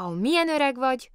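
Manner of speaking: high, wondering exclamation; amazed. A narrator's reading. Bear hearing Lotta's age, amazed. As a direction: marvel at how old that is. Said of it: "Csodálkozott Kóbor Muki".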